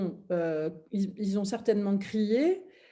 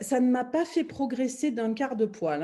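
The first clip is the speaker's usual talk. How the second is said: ordinary talk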